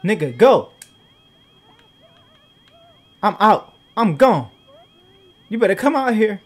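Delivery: loud, high commands to flee; urgent shouting. Real, not acted. Gamer shouting at his sims to flee the fire. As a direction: yell them out the door.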